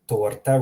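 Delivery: "torta" with a trilled R, rising; rolled R. Shown as a demonstration. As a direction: roll the R in "torta". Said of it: "roll the R".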